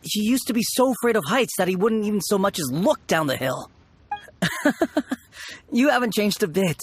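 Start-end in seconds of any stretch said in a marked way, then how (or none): 4.4-5.1 short high laugh; brief amused chuckle; fond laugh. Acted. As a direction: laugh at an old friend's habit. Friend laughs at old memory.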